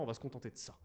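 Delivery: ordinary delivery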